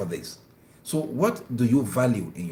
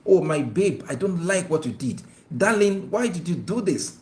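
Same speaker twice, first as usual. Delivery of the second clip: tender displeasure with pet names, higher; gentle loving rebuke; 6 semitones higher than usual. Shown as a demonstration. Husband gently scolding his wife, tender but displeased. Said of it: "a husband is angry with the wife".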